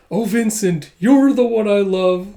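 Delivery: hammed-up declaration of love; soap opera drama. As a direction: swoon like a soap heroine. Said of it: "a little soap opera drama".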